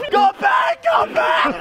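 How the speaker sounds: a rider with taped feet yelling to go back, panicked; panicked shout. Real, not acted.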